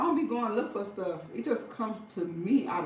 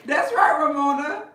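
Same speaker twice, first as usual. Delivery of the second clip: louder, emphatic agreement; 7 semitones higher, 11 dB louder than usual. Real, not acted. Cheering Ramona on, loud emphatic agreement. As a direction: back her up loudly.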